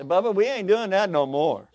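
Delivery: loud, high, playful refusal; mock firm warning. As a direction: put your foot down loudly, hammed up.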